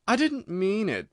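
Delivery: high, slow pleading; a gentle plea. A narrator's reading. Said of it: "pleaded poor Alice".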